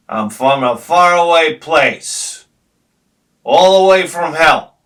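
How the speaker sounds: slow, loud, ominous delivery; menacing guy. Retold or imitated. Friend's menacing line, slow and loud. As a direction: drag it out like a villain. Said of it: "he goes just like this".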